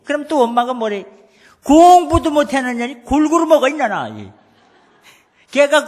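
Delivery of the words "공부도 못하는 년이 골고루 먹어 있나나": loud, higher scolding shout at the daughter; mother yelling. Retold or imitated. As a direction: bark at the child over dinner like an angry mother. Mother yells at daughter at table.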